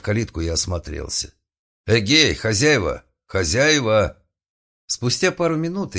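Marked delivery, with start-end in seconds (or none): 1.9-4.2 loud slow call across a yard. A narrator's reading. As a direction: hail the house owners loudly. Calling out at gate.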